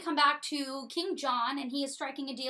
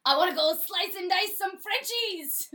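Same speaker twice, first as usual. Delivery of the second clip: higher, louder, gleeful battle lust; itching for a fight; eager bastard; 8 semitones higher, 6 dB louder than usual. Retold or imitated. The bastard begging King John to lead the army, gleeful. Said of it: "and so he goes".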